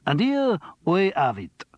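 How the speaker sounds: hammed-up French accent, slow and high; exaggerated French accent. A narrator's reading. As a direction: play the French detective, overdone. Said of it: "in an exaggerated French accent".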